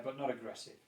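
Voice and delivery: ordinary talk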